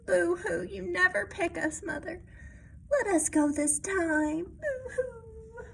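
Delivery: high, whiny mock sobbing; boo-hoo like a sulky little kitten; tearful high kitten pleading; kitten crying. Kittens crying to Mother Cat.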